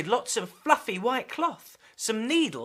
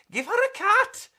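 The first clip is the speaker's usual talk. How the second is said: much higher, louder complaining voice; squeaky neighbour; 14 semitones higher, 7 dB louder than usual. Acted. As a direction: whine it sharply. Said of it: "complained Mister Figs".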